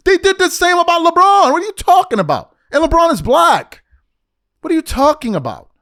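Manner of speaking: high, loud exasperated rant; heated outburst. Real, not acted. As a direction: let the frustration burst out loud. Heated rant about race claims.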